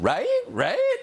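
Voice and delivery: "Right?" swooping sharply up at the end; mocking upspeak. Host imitates a cable news pundit, mocking upspeak. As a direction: imitate her rising inflection, high-pitched. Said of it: "Inflection at the end".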